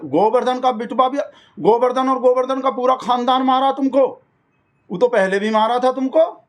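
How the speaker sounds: high-pitched, alarmed questioning; agitated phone call. Acted. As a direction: exclaim down the phone in mock concern. Acted phone call with distressed Santosh.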